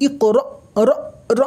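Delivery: ইক র with a sudden catch cutting off র; jerked stop. Shown as a demonstration. Quran teacher showing how sakin alif is read, jerked stop. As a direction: jerk র to a halt in the throat. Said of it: "এমন ঝাটকা হবে".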